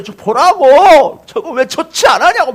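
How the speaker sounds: loud, high-pitched imitation of an irate coach; acted angry protest. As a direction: storm at the referee in a hammed-up yell. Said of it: "막 삿대질하면서 뛰쳐나가니까".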